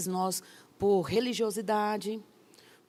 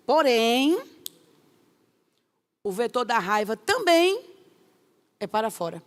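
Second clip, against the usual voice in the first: loud preaching; 2 semitones higher, 7 dB louder than usual. Acted. Preaching emphasis.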